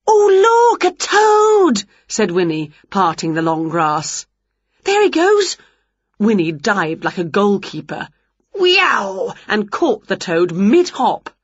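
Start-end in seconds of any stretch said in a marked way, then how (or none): 0.1-1.8 loud, high, drawn-out cry of excitement; shrieky witch spotting something; excited discovery. A narrator's reading. Winnie spots a toad. 4.8-5.6 high, loud shout; urgent, high witch yell; excited cry. A narrator's reading. Winnie chases escaping toad. 8.5-9.4 high swooping cry; long swooping "weow"; diving whoop. A narrator's reading. Winnie dives like a goalkeeper.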